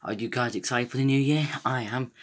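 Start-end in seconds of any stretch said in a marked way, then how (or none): none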